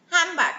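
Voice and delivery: "handbag" as "hambag", with a nasal m; assimilated nasal sound. Shown as a demonstration. Teacher demonstrating the nasal sound, assimilated "handbag". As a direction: drop the d in "handbag" and close on m. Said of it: "automatically becomes".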